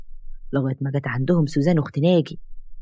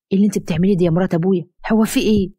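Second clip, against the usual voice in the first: fast, higher startled outburst; shocked; 4 semitones higher, 4 dB louder, faster than usual. Acted. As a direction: blurt it out in disbelief. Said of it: "اتكلم ساعتها بكل صدمة".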